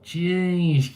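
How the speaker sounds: normal talking voice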